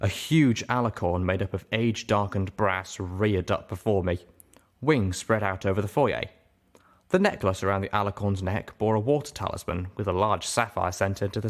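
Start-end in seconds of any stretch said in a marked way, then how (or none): none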